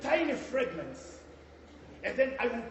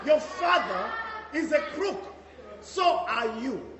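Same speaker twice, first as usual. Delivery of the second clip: scolding; 3 semitones higher, 8 dB louder than usual. Acted. Headmistress berates pupil.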